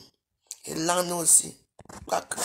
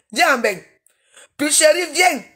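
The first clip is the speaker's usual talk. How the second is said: loud call, pitched higher; 5 semitones higher, 6 dB louder than usual. Real, not acted. Calling out to Picheri on her live stream, loud and high.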